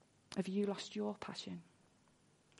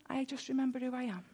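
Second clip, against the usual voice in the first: ordinary talk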